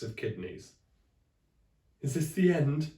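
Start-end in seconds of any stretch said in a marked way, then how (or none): 2.0-2.9 louder, higher hammed-up despair; despairing cry. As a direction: wail in mock panic. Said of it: "cried Friday".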